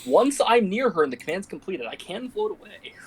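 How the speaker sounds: usual speaking voice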